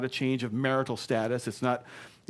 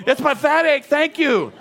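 very high, loud exclamation; scornful shout; 14 semitones higher, 10 dB louder than usual. Shown as a demonstration. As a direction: cry out in comic outrage. Climax of shouted disdain, comic.

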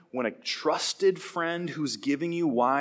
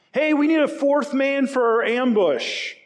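higher, louder, slow hammed-up gang recruiter; mock robber calling out; 11 semitones higher, 7 dB louder than usual. Robber openly recruiting in the city gates, hammed-up loud call. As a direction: shout it out to the whole square like a brazen robber.

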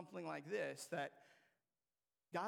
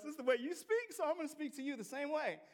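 God's reply; 6 semitones higher, 5 dB louder than usual. Retold or imitated. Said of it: "how God responds to us".

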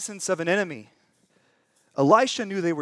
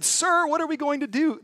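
higher, stiffly polite reading of the servant; polite servant, mock-proper; 9 semitones higher than usual. Shown as a demonstration. Preacher reads the stiff translation aloud, politely higher. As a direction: read the tidy translation primly, a touch higher. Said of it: "here's what the text I read said".

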